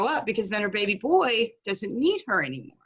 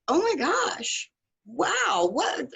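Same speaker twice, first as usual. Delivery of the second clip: slow, high drawn-out oh my gosh; stunned; 6 semitones higher, much slower than usual. Retold or imitated. Coach hearing about the maid, stunned and slow. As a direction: let the shock sink in slowly.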